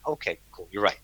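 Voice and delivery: meek agreement. Retold or imitated. Answering the inner voice, softly giving in. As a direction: drop almost to nothing and concede. Gives in to the voice.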